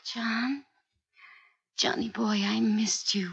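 whisper. Acted. Wife greets returning husband softly.

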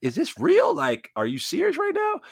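building from muttered shock to outcry; stunned disbelief. Retold or imitated. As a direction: player reliving the moment he learns of the trade, hushed then incredulous. His reaction to the news.

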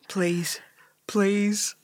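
soft, mock-seductive pleading; sexy and deep. Trying a better way to ask, soft sexy murmur. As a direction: try out a low sexy please. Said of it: "trying to be real sexy and deep".

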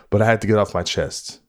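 usual speaking voice

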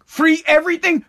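normal talking voice

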